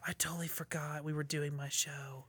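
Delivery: soft unvoiced whisper. Real, not acted. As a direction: whisper it under your breath. Whispered aside.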